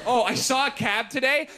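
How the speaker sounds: normal talking voice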